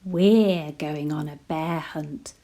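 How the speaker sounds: plain reading voice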